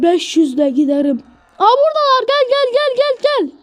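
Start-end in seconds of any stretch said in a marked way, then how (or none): 1.6-3.5 much higher, urgent calling; being chased. Pretend. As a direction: hurry them along in a panic. Pursuers appear, urging partner. (kovalanırken heyecanlı bağırış)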